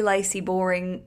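usual speaking voice

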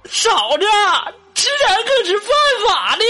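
high-pitched crying plea. Retold or imitated. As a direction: wail it through tears. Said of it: "就边哭啊边说呀".

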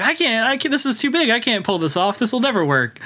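high-pitched mock panic; John Lovitz. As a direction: imitate someone balking at a plan. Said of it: "John Lovitz is saying".

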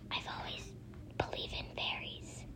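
a breathy, voiceless hush; whispered line to a friend. A narrator's reading. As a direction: whisper it back like a secret. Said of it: "Christy whispered back".

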